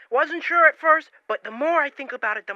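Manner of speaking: excited caller. Acted. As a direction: burst out eagerly. Caller gushes.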